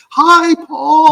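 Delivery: high, loud sing-out hello; imitated group greeting. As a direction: call a long hello down the restaurant. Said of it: "they now realize we're here".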